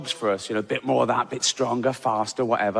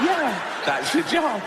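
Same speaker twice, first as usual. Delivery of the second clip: high-pitched, eager little-dog voice; delighted poodle; 10 semitones higher than usual. Acted. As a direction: squeak it out like a thrilled lapdog.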